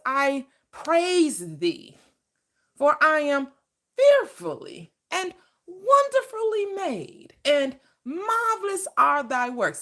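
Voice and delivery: slow, high, reverent psalm reading; psalm recital. Acted. Preacher reciting the psalm to the congregation, slow and reverent. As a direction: lift each word of the psalm slowly. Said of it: "what it says".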